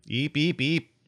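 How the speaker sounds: high, rising little eeps; creature chirps. Acted. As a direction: chirp like a small alien pet.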